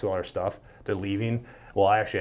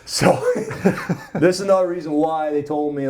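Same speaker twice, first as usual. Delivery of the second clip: laughing; 8 semitones higher, 7 dB louder than usual. Real, not acted. Laughing while telling story.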